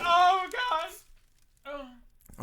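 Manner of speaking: high, pained moaning; pained groan. Pretend. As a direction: groan from the burn. Burned, in pain.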